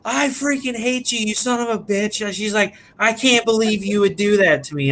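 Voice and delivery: playing the pranked wife blowing up at her husband; imitating furious wife.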